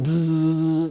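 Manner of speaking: a slow, loud, buzzing vacuum noise; playful imitated vacuum drone. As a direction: mimic the hum of a vacuum cleaner. Salesman vacuuming up dirt.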